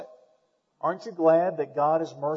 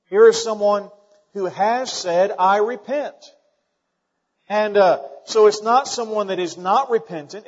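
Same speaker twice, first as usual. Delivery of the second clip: loud, high preaching at full force; fiery preaching; 6 semitones higher, 8 dB louder than usual. Acted. Pastor pressing the church to forgive, preaching hard. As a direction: drive it home from the pulpit.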